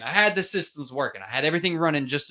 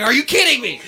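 higher, louder exasperated rant; fired-up retold outburst, pitched way up; heated retelling; 10 semitones higher, 6 dB louder than usual. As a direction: relive the fury of the dead mic. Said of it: "And I was heated".

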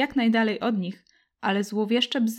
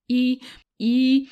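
faltering stammer; 3 semitones higher, 5 dB louder than usual. Acted. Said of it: "brnął Marcin".

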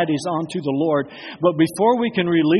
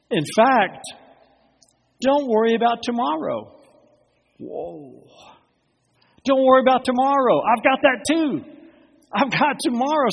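reassuring; 8 semitones higher than usual. Acted. Preacher voicing Jesus, raised.

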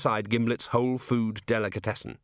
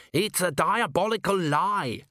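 indignant shout; 9 semitones higher, slower than usual. A narrator's reading. Accused dwarf cook protests from under the heap, indignant shout. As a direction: cry out in mild mock outrage. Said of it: "shouted Gimlet from somewhere".

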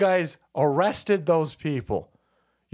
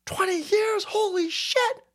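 much higher astonished gasp; high-pitched stunned "holy shit"; squeaky shocked disbelief; slower than usual. Real, not acted. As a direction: shriek it like you can't believe it. Shocked at time passing.

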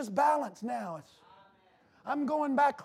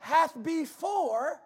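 slow, louder stretched preaching; 3 semitones higher, 5 dB louder, slower than usual. Acted. Preacher pressing the congregation on predestination, slow and loud. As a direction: drag out ordained for weight.

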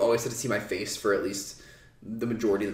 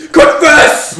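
screaming confess; shouted demand; 21 dB louder than usual. Retold or imitated. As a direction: yell it at full force. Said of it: "He says".

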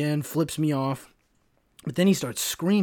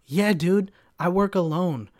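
higher, louder sarcastic retort; mock snapping back; 5 semitones higher, 5 dB louder, slower than usual. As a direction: toss it back at him with attitude. Retold snapping back at customer.

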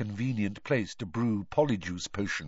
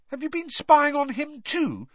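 high, sharp indignant question; 5 dB louder than usual. Acted. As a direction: bristle with offence. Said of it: "said Harry indignantly".

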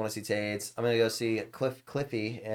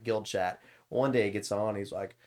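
usual speaking voice

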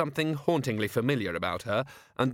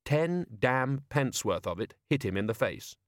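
plain reading voice

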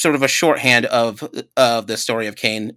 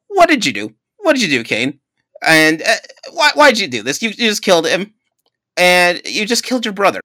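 higher, louder, mock outraged questioning; accusing God; 7 semitones higher, 3 dB louder than usual. God confronting Cain over the killing, mock outraged. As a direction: demand answers, hammed-up shock. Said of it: "The first thing he says".

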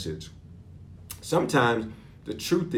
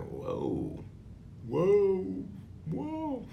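alarmed whoas; 8 semitones higher, 6 dB quieter, much slower than usual. Retold or imitated. Preacher recalls watching the news, mock alarmed whoas. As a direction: re-enact dawning alarm, softly.

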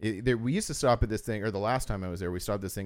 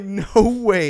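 amazed disbelief; 10 semitones higher, 11 dB louder than usual. Retold or imitated. Reacting to the cooler confession.

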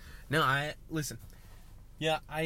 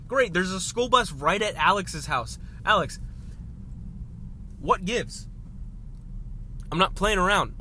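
loud, raised-pitch indignation; getting mad; 6 semitones higher, 5 dB louder than usual. Real, not acted. Driver finds a bus at friend's house, indignant. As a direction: complain loudly, pitch up. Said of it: "I was about to get so mad".

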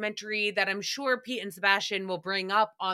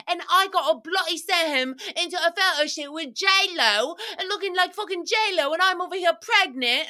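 British, higher and louder; 7 semitones higher, 4 dB louder than usual. Retold or imitated. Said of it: "she's like".

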